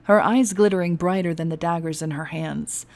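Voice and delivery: plain reading voice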